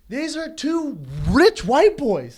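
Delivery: loud slow mockery. Real, not acted. Mocking the dads' hopes.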